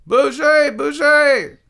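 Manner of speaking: high, loud acted shout; Derrida. A narrator's reading. Derrida at the honking driver, shouting. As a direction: yell it at full pitch. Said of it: "shouted Derrida".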